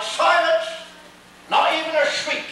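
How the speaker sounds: sharp loud cry for silence; shouting bellman. A narrator's reading. Bellman shouting for silence aboard ship. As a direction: bark the order to hush them all. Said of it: "the bellman cried".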